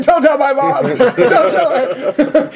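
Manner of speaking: high, laughing whine, very slow at the end; mock drunk pleading. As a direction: beg like a sloppy drunk kid. Drunk self begging, retold.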